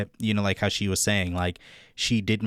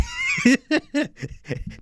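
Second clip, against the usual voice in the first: high burst of laughter; real laugh. Real, not acted. Vlogger laughing at not wanting to die, high laugh. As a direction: laugh at your own joke. Laughs at own joke about dying.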